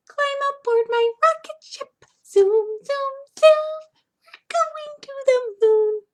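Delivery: very high, tiny squeaky mouse singing. Pretend. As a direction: sing it like a little squeaking mouse. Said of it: "How about we do a squeaky voice?".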